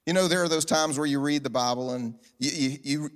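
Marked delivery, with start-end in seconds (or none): none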